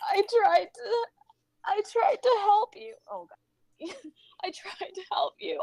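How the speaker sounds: sobbed apology, breaking into whispers; tearful, halting, pitch jumping between sobs; crying harder. Acted. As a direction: apologize through heaving sobs. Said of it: "he begins to cry harder".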